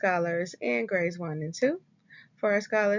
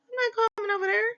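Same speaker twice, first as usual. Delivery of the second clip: very high, fast elf refusal; squeaky stubborn elf; 13 semitones higher, much faster than usual. Acted.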